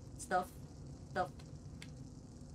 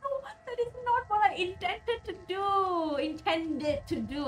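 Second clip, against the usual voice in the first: dismayed cry; 8 semitones higher than usual. Real, not acted. Accidentally kills pet creature.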